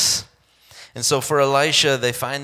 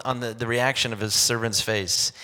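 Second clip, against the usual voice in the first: ordinary talk, nothing marked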